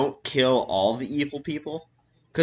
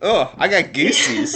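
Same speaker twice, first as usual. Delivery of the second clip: laughing. Real, not acted. Laughing at the freaky voice.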